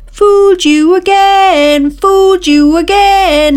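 high, loud, slow gloating shout. Acted. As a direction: shout it gleefully. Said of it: "he shouted".

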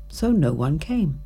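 ordinary delivery

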